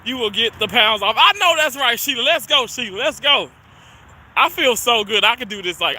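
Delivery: usual speaking voice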